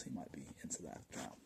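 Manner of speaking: usual speaking voice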